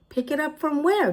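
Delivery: high feigned puzzlement; high, light put-on puzzlement; mock innocent question. A narrator's reading. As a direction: play innocent.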